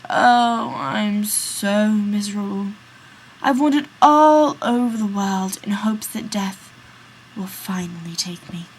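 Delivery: put-on weary old man whispering that death will take him; miserable old man.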